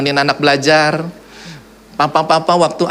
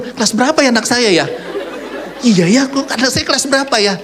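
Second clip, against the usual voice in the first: confused father; 8 semitones higher than usual. Retold or imitated. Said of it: "Dia bingung".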